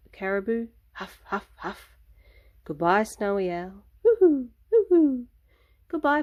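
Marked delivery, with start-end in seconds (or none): 0.9-1.9 breathy caribou huff. Acted. Caribou huffing again. 4.0-5.3 high, loud hooting owl call. Acted. Saying goodbye to the owl, a high, loud hoot. Snowy owl sound again.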